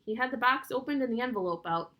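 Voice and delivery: plain reading voice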